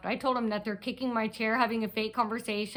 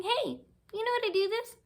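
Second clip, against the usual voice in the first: high, put-on questioning voice; mimicking fake friendliness; 11 semitones higher, 4 dB quieter than usual. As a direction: imitate her asking, pitched way up. Mimics woman faking a conversation.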